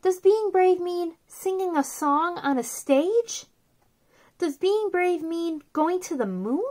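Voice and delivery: soft, gentle read-aloud of a picture book; storybook reading. Acted. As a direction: read it softly to little kids, like a bedtime book.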